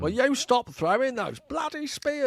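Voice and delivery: high, slow, mock Cockney Michael Caine; Michael Caine impression. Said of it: "It's always the same accent".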